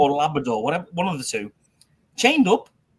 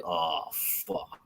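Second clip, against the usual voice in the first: low, soft sinking realisation; dismayed; 8 semitones lower, 11 dB quieter than usual. Retold or imitated. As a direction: mutter it as it dawns on you. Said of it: "I was like".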